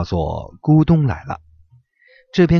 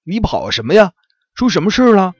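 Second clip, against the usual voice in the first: high, louder curious fox voice; fox asking; 8 semitones higher, 5 dB louder than usual. Acted. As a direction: ask hurriedly as the puzzled fox. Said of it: "忙问".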